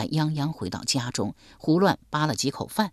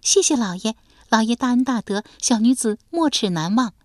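delighted; 7 semitones higher, 3 dB louder than usual. Acted. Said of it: "满脸欣喜地说".

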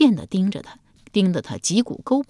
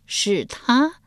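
higher, rising disbelief; surprised query; 6 semitones higher than usual. A narrator's reading. Empress dowager hearing who wrote the memorial, surprised.

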